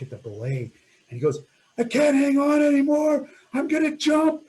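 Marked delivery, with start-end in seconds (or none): 1.8-4.4 high, loud yelled warning; exhausted climber shouting to his partners below; desperate shout. Retold or imitated. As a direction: yell it down from the ice pillar. Climber yelling down he'll jump.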